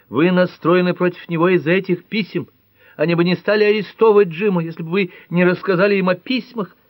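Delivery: high, loud hysterical outburst; hysterical accusation. Acted. Nora blames Ellery for the arrest, high hysterical. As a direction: spit out the blame, high and fast.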